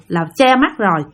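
ordinary delivery

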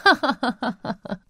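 spelt-out mock laugh; mother laughing. A narrator's reading. Mother laughing at grandma's idea, spoken laugh. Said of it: "засмеялась мама".

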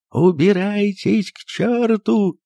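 high, slow, tearful cry; tearful shout. A narrator's reading. The doctor chases the guests off, crying out high and tearful. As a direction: cry out near tears. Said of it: "крикнул он плачущим голосом".

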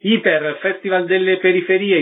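ordinary talk, nothing marked

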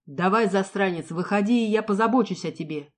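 loud furious taunt; ringing with rage. Acted. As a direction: throw it back at the mercenary. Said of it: "Голос отца звенел от ярости". (голос, звенящий от ярости)

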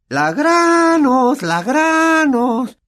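very high, loud, slow chant of Granos; taunting shout. A narrator's reading. Peeves chasing Myrtle, shouting taunts. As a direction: chase her yelling. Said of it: "gritándole".

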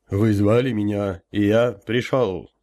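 slow, resonant rumble of a summoned elder; booming elder voice. Acted. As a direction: boom it out slowly like a spirit. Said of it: "прогудел я".

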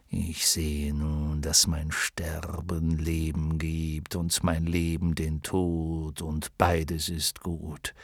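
low, slow, whispered lion; solemn lion voice. Acted. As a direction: murmur it low in awe. Lion finds meaning, grateful.

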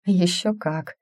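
plain reading voice

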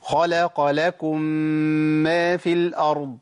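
slow recitation, merged mim of لكم ما held two counts; slow Quranic tajweed demo; two-beat shaddah. Shown as a demonstration. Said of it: "أقول إيه؟".

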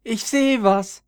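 high, loud, happy shout; a cheerful human spotting the violets, calling out high. A narrator's reading. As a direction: call out with delight at the find. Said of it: "rief eine fröhliche Menschenstimme".

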